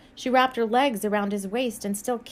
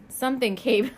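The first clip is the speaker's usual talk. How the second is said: usual speaking voice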